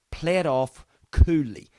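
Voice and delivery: normal talking voice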